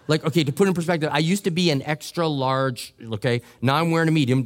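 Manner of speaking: normal talking voice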